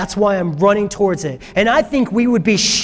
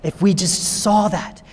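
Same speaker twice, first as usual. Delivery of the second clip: normal talking voice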